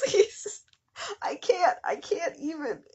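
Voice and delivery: high, cracking laugh-cry; laughing too hard to speak; helpless laughter. Real, not acted. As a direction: voice breaking into laughter. Breaking down laughing.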